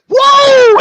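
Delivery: loud high startled yell; startled shout. Retold or imitated. Woman reacting to the crude line, loud startled shout. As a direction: recoil in shock. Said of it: "you're like".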